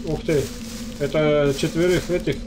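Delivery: ordinary talk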